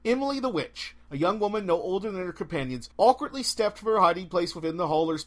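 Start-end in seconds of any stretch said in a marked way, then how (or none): none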